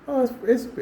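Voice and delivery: high, delighted gasp of admiration; imitating an admiring woman. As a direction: voice a woman charmed by the garden. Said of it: "a woman will walk past".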